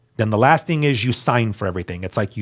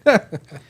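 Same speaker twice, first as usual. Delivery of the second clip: short laugh; 7 semitones higher than usual. Real, not acted. Laugh at own naive hope.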